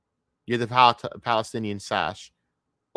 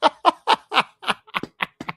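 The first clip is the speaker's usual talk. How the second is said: high, loud burst of laughter; staccato high-pitched cackle; laughing; 6 dB louder than usual. Real, not acted. Laughing at the hypocrisy clip.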